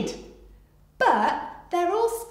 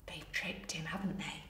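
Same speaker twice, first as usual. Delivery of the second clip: much softer, lower, near-whispered; hushed aside; 5 semitones lower, 11 dB quieter than usual. Real, not acted. Storyteller aside to children about the trick, near-whisper. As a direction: confide it quietly to the listeners.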